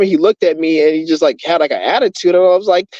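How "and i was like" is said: ordinary delivery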